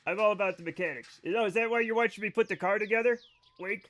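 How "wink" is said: usual speaking voice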